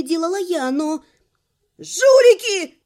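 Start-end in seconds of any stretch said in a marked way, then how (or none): 1.8-2.7 loud high shout from the back; shouted from afar. A narrator's reading. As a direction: yell it from the top rows. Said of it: "донеслось с верхних рядов".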